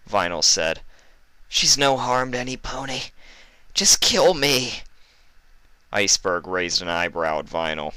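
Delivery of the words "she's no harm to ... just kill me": higher, louder begging; desperate plea. Acted. Vinyl pleading with Iceberg to spare Fluttershy, desperate. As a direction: beg him to take you instead.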